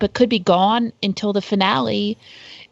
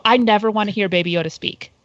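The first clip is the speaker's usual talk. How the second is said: ordinary delivery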